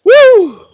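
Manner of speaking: high, loud "woo"; excited whoop. Real, not acted. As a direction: let out a sharp, high whoop. Whoops while working.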